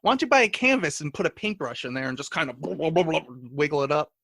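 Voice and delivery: ordinary talk, nothing marked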